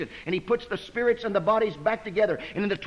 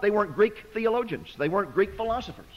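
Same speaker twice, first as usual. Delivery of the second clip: ordinary talk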